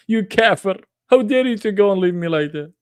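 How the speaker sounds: high hammed-up accusing; mock outrage at being left; mock indignant. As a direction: scold him in jest. Mock scolding the departed guest.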